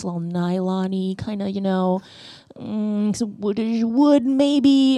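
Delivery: slow, halting pretentious tasting notes; mock wine lady. Woodstock wine lady pours rosé, slow and pretentious. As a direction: drag out each note like a snooty sommelier. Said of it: "she goes".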